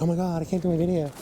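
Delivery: hammed-up griping; mock complaining. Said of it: "so I can complain".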